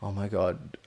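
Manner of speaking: ordinary talk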